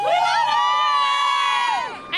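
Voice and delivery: cheering, rising into a shout; excited cheer. Real, not acted. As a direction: cheer it out loud. Fan cheering at parade.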